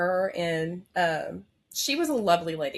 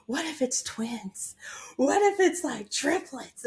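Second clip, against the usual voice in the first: excited what-if; 8 semitones higher, slower than usual. Retold or imitated. Excited wife to husband.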